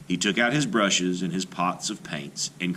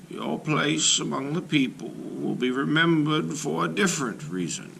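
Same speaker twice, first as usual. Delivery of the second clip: slow, higher, distant-sounding elder; wise shaman; 9 semitones higher than usual. Acted. Wise shaman counsels young Little Gopher about his path, slow and high. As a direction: speak slowly and high, like a wise shaman from a vision.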